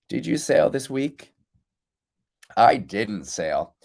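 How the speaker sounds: ordinary talk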